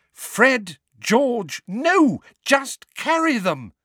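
high, loud, panicked shriek. Acted. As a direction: shriek at the boys to stop. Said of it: "Missus Weasley shrieked".

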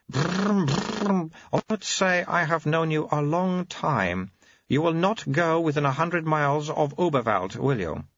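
put-on very high, babyish old abbot voice; an ancient mind in a baby's mouth; high piping infant abbot; baby-bodied old abbot. Abbot babbling then warning.

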